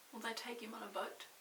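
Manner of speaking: usual speaking voice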